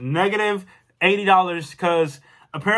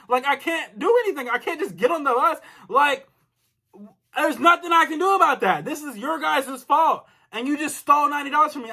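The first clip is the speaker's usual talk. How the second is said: higher-pitched, heated protest; mad; 5 semitones higher than usual. Retold or imitated. Customer telling the agent it's their fault, mad. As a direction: argue it out, exasperated and insistent. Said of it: "I'm just mad right now".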